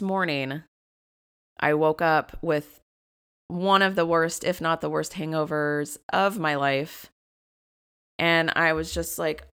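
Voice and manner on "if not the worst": ordinary talk, nothing marked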